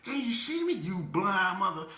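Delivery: high, loud sing-song mockery; mocking taunt. Woman mocking the speaker's thick glasses, high and loud. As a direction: tease him about his glasses. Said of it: "She goes".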